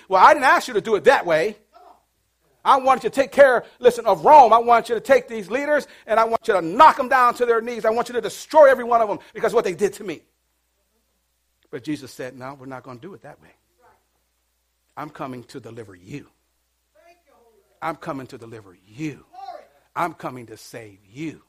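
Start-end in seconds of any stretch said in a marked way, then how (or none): 0.1-10.2 loud, raised, insistent orders; indignant demand. Acted. Crowd angrily demands vengeance of Jesus. 12.4-21.4 low, soft, steady reply; calm reassurance. Acted. Jesus calmly answers the angry crowd.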